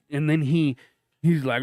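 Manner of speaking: ordinary talk, nothing marked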